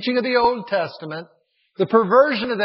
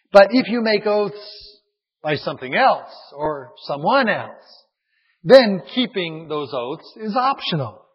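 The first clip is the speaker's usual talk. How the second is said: ordinary talk, nothing marked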